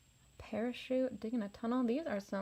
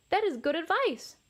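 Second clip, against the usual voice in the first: higher, louder delighted thanks; grateful excitement; 7 semitones higher, 6 dB louder than usual. Acted. Little rex thanking his siblings, higher and excited. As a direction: say it with bright delight.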